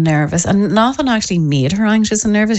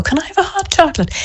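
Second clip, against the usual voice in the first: put-on high, fast little-boy voice; mimicking a cheeky child begging for a treat; eager child; 12 semitones higher, faster than usual. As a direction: blurt it out like a greedy wee boy. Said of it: "And he'll go".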